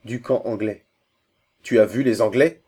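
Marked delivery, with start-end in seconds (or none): 1.6-2.6 sudden loud, angry question; revived by anger, voice lifted; roused to anger. A narrator's reading. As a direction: exclaim it, temper flaring up. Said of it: "ranimé par la colère".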